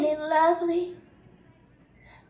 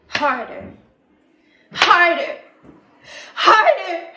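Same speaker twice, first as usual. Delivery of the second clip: escalating intensity; 3 semitones higher, 8 dB louder, slower than usual. Acted. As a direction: build HARDER into a forceful cry. Retells hitting herself, escalating.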